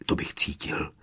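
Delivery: hushed, nearly voiceless murmur to oneself; self-persuasion. A narrator's reading. Harry trying to convince himself, hushed. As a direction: say it under your breath, softly. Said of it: "přesvědčoval Harry sám sebe".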